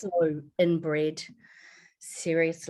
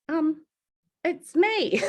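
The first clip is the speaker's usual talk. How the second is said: nervous; 9 semitones higher, much slower than usual. Retold or imitated. Nervous first words to mother.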